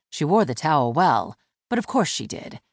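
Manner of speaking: plain reading voice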